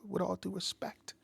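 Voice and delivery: ordinary delivery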